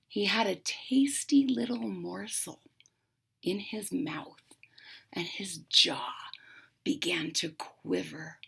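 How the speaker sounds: hushed whisper building tension; suspense whisper. A narrator's reading. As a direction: whisper the fox's secret.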